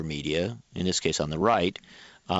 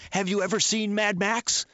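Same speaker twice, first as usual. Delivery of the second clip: much higher-pitched imitation of the ad woman; mock incredulous question; 11 semitones higher than usual. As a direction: parody her alarmed question, pitched way up. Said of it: "she actually says in the ad".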